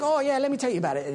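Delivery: high, fast mock-British voice; quick, high-pitched bad British impersonation; terrible British accent. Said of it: "that's probably a terrible British accent".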